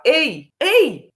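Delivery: loud sharp call to get attention; loud warning call. Retold or imitated. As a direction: call out across the room to stop him. Mother calling out to son.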